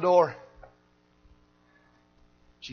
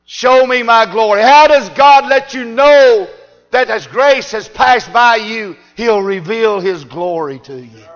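louder, slow, driving preacher cadence; rising preaching; 5 semitones higher, 17 dB louder, slower than usual. Acted. Preacher turning back to his grace text, climbing louder. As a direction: build it back up to the pulpit roar.

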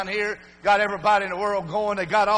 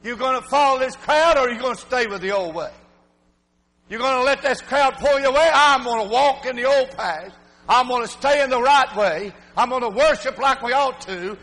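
louder, higher rousing preaching; preaching at full force; 4 semitones higher, 4 dB louder than usual. Real, not acted. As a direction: revival sermon climax.

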